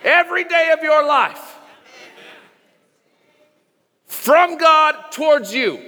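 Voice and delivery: high, ringing preacher's climax; preaching at full force. Real, not acted. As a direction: build it up, preaching with full fire. Preaching climax, rising fervor.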